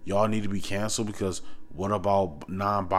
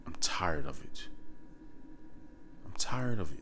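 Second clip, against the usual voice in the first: real weary whisper, much softer; fed-up whisper; 4 semitones lower, 9 dB quieter than usual. Real, not acted. Speaker sick of the hashtags and pronouns, fed-up whisper. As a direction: drop to a whisper, utterly fed up.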